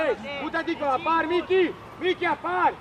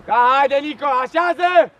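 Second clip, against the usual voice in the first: coach shout; 7 dB louder, slower than usual. Real, not acted. Coach yelling at Nico to settle, building to loud slow shout. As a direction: bellow the last word drawn out.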